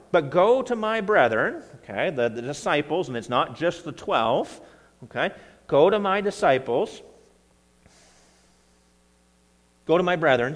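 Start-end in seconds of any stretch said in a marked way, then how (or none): 0.1-1.6 urgent command. Acted. Jesus sends Mary to brethren. 5.7-10.5 urgent command. Acted. Jesus sends Mary to disciples.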